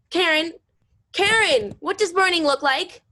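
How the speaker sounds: loud, high alarmed call; panicked shout. Acted. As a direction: yell her name in panic. Panicked calling out.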